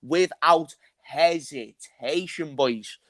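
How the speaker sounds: ordinary talk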